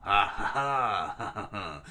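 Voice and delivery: defiant stagey ha-ha; Luffy laughing back. Acted. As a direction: laugh boldly in the villain's face. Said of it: "Luffy then laughs in return".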